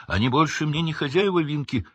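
high, defiant elf retort. A narrator's reading. Dobby answering Winky's scolding, high and defiant. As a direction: stand up to her boldly. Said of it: "с вызовом произнёс Добби".

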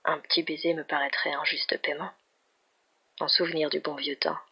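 softly voiced, tentative proposal; soft request. A narrator's reading. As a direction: ask quietly for a small favour. Shy request for a kiss. (demande à voix douce)

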